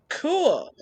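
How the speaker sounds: a sudden leap up on cool; high-pitched cool. Retold or imitated. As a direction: squeak it out in surprise. Sudden delighted surprise.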